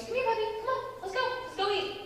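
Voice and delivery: very high sing-out to a dog; calling a puppy. Acted. Acting out waking the dog.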